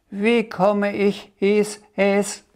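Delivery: hohe, langsame, laute Drachenstimme; fragender Drache. A narrator's reading. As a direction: gedehnt und hoch wie ein kleiner Drache fragen. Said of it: "blaue Drache fragte". (kleiner Drache, hoch und langsam)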